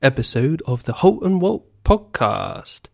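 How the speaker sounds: slow, hammed-up show opening; podcast host intro. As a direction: announce the show like a radio host, drawing it out.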